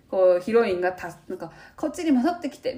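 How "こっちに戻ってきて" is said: imitating heroine. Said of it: "みたいなの".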